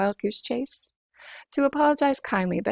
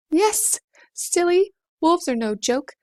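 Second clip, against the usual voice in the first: high, loud and slow, teasing; bright retort; 8 semitones higher, 5 dB louder, slower than usual. Acted. Rosie answering her own question, high and loud. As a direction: tease yourself for even asking.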